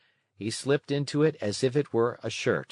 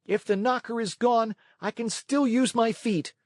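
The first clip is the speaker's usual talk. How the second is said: much higher angry puppet voice; a furious boy threatening; mock rage; 12 semitones higher than usual. A narrator's reading. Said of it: "blind with rage".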